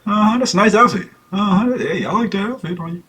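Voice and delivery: high, softening placating replies; mock half-hearted compliments to a girlfriend; imitated unconvincing boyfriend. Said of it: "You gonna know if he like it".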